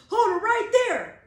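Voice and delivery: loud, high shouted order; a loud police halt; policeman's command. Acted. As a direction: stop them cold like a cop. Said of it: "said the policeman".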